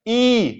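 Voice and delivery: ई drawn out as a long ee; long E sound. Shown as a demonstration. Teacher shows students बड़ी ई, a long held ee. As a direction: hold the vowel in ई long. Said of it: "जिसे हम बोलते हैं बड़ी ई".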